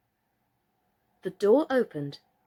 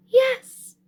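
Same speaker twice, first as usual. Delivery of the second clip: much higher, loud yes; eager doll; 9 dB louder than usual. A narrator's reading. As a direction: chirp it out. Birdie eager agreement.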